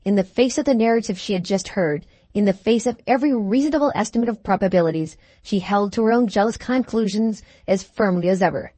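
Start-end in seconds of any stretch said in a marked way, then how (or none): none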